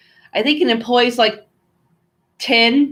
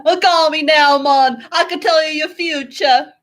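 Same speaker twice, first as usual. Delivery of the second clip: put-on a loose, slightly raised Jamaican accent on a psychic ad line; light Jamaican accent, louder and higher, hamming up a psychic ad; Miss Cleo Jamaican accent; 6 semitones higher, 4 dB louder than usual. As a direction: do a playful Jamaican accent like a TV psychic hotline host. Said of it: "I can't do that Jamaican accent like she can".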